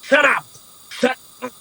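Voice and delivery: high, urgent shut up; a hint of a scared captive; hissing hush. Captive hushing the rogue in panic, high urgent.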